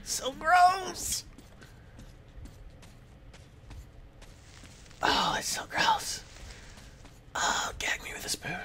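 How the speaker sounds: hushed, grossed-out whispering; disgusted whisper. Real, not acted.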